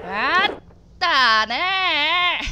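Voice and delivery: loud, high, drawn-out what the heck; a real jolt of alarm, stretched slowly; shocked yelp at the screen; startled outcry. Real, not acted. Sudden shock during the game.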